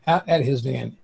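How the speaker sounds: ordinary delivery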